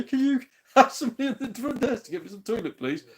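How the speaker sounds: high laughing mock plea. Stuck in a toilet cubicle, asking for toilet roll while laughing. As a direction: giggle through the request. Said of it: "I can't go, 'Excuse me".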